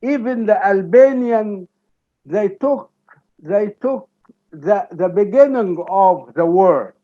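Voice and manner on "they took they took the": usual speaking voice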